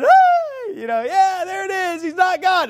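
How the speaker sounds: very high, loud gloating whoop; mock triumphant cheer. Preacher imitates Witnesses celebrating, very high mock glee. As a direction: cheer like a fan after a goal. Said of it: "get all excited".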